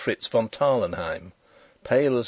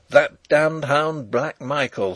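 slow, raised, mildly seething curse; through the teeth; 5 semitones higher, 5 dB louder, slower than usual. A narrator's reading. Fritz naming who drugged the King, seething slowly. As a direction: force it out slowly between the teeth. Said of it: "said Fritz between his teeth".

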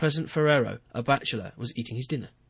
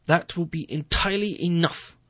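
louder, curt cut-off; snapped; 6 semitones higher, 6 dB louder than usual. Acted. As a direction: shut him down mid-sentence. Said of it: "I snapped".

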